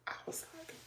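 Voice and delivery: ordinary talk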